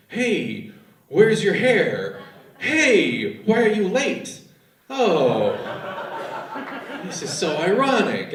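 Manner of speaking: loud, rising mock surprise at the gift mix-up; hammed-up shock at the ironic gifts. Couple on Christmas morning discovering the gifts, hammed-up shock. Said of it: "they're like".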